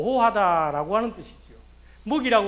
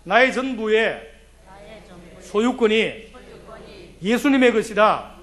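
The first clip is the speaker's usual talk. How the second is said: slow, loud declaration for all to repeat; leading a response; 4 dB louder, slower than usual. Shown as a demonstration. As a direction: proclaim slowly and louder for the church to repeat. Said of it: "따라합시다".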